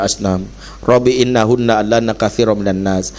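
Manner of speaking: low, steady recitation of Arabic verses; Quran recitation. Acted. Preacher reciting verses to the congregation, chanted. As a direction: intone the prayer of Ibrahim reverently.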